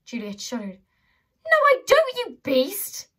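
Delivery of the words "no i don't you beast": high, loud "No, I don't"; horrified outburst. Acted. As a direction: recoil and yell at her. Juliet horrified at Holly.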